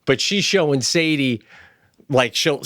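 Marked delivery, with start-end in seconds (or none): none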